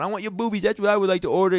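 high, loud crazy-man voice; hammed-up unhinged customer, pitched well up; acting crazy. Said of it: "He started acting crazy".

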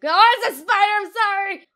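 loud high yelp; startled shriek. Real, not acted. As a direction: jump at the spider. Startled by spider.

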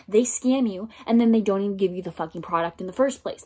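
fast, run-together rant; quick-fire and irritated; rapid complaint. Real, not acted. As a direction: rush the whole line out in annoyance. Says it, then repeats for emphasis.

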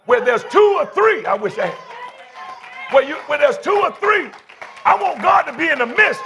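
high, shouted church preaching; full-force preaching. Real, not acted. Pastor rallies weary congregation to praise, full-force preaching.

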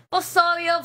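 loud, cocky "obvio" with a laugh; emphatic. Real, not acted. Repeats answer, second louder.

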